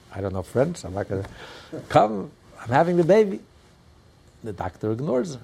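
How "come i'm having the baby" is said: high, loud imitation of a woman in labor; mock elegant yell. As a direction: call out urgently but genteelly. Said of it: "the daughter yells out".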